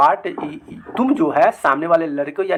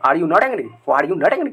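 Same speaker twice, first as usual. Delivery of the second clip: ordinary talk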